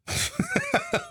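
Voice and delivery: a short high burst of laughter. Real, not acted. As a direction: laugh at your own joke. Laughing at own impression.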